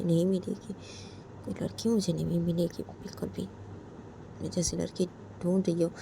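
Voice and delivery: ordinary talk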